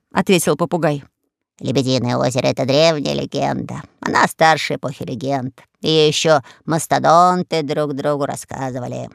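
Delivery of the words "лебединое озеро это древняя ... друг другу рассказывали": low, lofty parrot. Acted. Parrot answering Alice, low and lofty. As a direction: explain it like a wise old pirate parrot.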